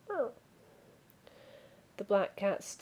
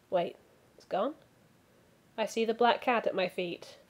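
ordinary delivery

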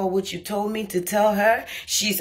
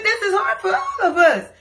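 laughing through tears; 13 semitones higher, 4 dB louder than usual. Real, not acted. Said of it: "laugh/cry".

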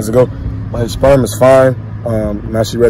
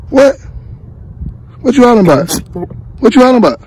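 dog talk; 10 semitones higher, faster than usual. Real, not acted. Asking the dog why it barks.

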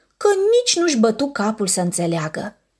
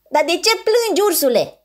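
high, gentle little penguin; lightly voiced penguin, high; 9 semitones higher, faster than usual. Kind penguin asks the bear.

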